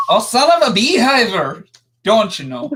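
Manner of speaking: Scottish attempt drifting into Jamaican; wandering Scottish accent, ending vaguely Jamaican; bad Scottish-Jamaican accent. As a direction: ham up a muddled Scottish-Wisconsin accent. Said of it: "So we went Scottish for a bit".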